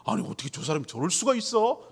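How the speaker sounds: ordinary delivery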